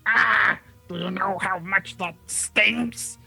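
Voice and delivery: loud pained whine, slow; pained complaint. Acted. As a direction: whine through the sting. Goblin complains in pain.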